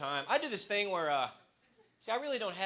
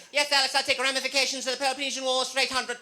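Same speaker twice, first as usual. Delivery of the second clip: higher, louder know-it-all voice; smug Jeopardy contestant; 9 semitones higher, 8 dB louder than usual. Retold or imitated. Comic mocking a Jeopardy contestant, high and smug. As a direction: play the smug genius contestant. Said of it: "they're like".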